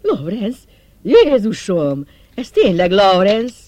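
loud, high burst of joyful recognition; joyful cry. Acted. Bernie recognising Lawrence at last, crying out in loud, high joy. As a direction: shout it out, reborn with joy.

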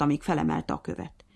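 ordinary delivery

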